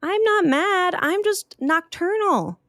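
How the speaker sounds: high, grumpy creature voice; a cranky bat just woken up; sleepy bat voice. Acted. Bat woken up, talking to Opal, high and grumpy.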